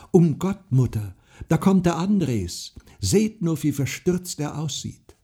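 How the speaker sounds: alarmed call. A narrator's reading. Girl at the window calls mother, alarmed. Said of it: "war sie schon ans Fenster gesprungen und rief".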